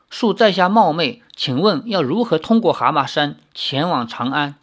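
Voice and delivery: usual speaking voice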